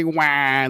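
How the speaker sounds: brief mock baby cry; imitated baby wail. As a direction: mimic a baby bursting into a wail. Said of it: "the baby starts crying".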